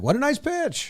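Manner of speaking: high, sarcastic "what a nice pitch"; mock praise. Fans mocking the pitcher, high sarcastic praise. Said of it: "Think we'd be like".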